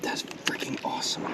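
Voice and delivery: soft awed whisper. Real, not acted. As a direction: breathe it out in awe. Quiet aside near the fish.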